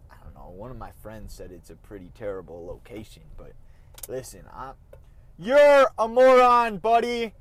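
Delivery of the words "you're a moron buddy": louder, slow, furious jab at another driver; angry insult. Real, not acted. Driver yelling at a blocking driver, real anger. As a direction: spit it out at the idiot in traffic.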